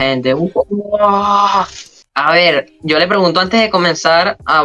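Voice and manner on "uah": long, high mock crowd "uah"; imitated crowd roar. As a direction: mimic a crowd roaring from afar. (imitando el griterío del público)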